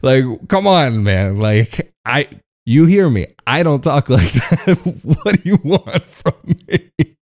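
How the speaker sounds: exaggerated laughing American drawl; hammed-up American bro voice; American accent. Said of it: "he starts doing an American accent".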